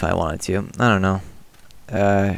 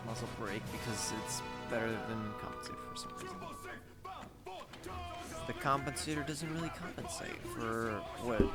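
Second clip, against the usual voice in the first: echoing duplicated audio; warpy double audio; 4 dB quieter than usual. Real, not acted. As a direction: voice doubled by headphone leak. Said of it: "it sounds really warpy and weird".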